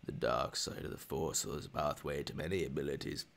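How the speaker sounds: Emperor voice. Pretend. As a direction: croon corruption like an old emperor. Palpatine opera line.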